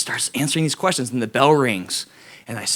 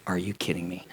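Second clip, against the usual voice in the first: dismayed mutter; 5 semitones lower, 10 dB quieter than usual. Retold or imitated.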